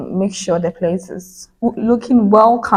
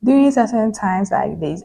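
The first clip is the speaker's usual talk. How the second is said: normal talking voice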